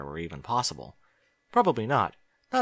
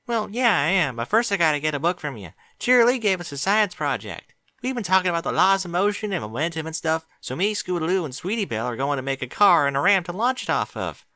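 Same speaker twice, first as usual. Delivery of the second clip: put-on higher young filly, chipper and eager; Apple Bloom's voice; 8 semitones higher than usual. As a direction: explain it in a rush like an excited kid.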